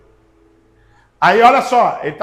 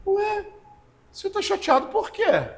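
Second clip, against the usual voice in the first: imitando uma pessoa sonsa, bem mais aguda e mais baixa; sonsa fingida; 10 semitones higher, 6 dB quieter than usual. Retold or imitated. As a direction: finja inocência, agudinho e suave. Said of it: "que é sonsa". (imitação sonsa e aguda de quem finge não ter ferido)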